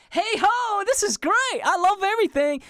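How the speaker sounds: very high, sing-song fake cheer; mocking a deluded optimist, high and bright; delusional cheerful voice. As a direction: play someone blissfully ignoring chaos. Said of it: "But you're like".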